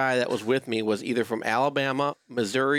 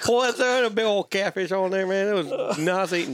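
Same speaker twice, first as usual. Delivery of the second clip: hammed-up Southern accent; 7 semitones higher than usual. [name] tells a catfish story to his buddies in a hammed-up Southern accent. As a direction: tell it like a good old boy from Georgia, a touch hammed-up. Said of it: "I'd start turning into boomhau".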